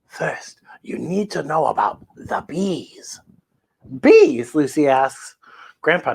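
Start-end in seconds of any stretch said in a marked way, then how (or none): 0.1-3.2 soft unvoiced storyteller whisper. Grandpa starting the Hanukkah tale for Lucy, whispered. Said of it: "Grandpa begins with just a whisper". 4.0-4.5 surprised. Acted. Lucy surprised question.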